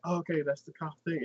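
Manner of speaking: soft, subdued acknowledgement; suddenly quiet. Retold or imitated. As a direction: imitate someone gone meek and quiet. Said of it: "she is completely quiet".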